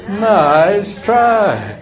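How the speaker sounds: high, drawn-out singsong "nice try"; cheerfully unfooled parent, stretched out; big-grin comeback. Shown as a demonstration. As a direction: sing it through a big grin. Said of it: "What's the answer?".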